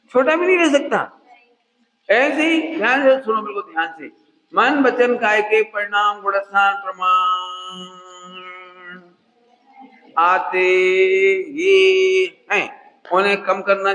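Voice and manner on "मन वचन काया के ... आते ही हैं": slow, level delivery with long held endings; drawn-out recital. Shown as a demonstration. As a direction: stretch it out so listeners can join in. Slow recital for listeners to complete. (धीरे-धीरे, शब्द खींचकर दोहराने जैसा)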